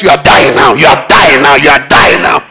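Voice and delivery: a hint of a menacing devil chanting doom; devil's taunting voice. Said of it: "it's the devil speaking".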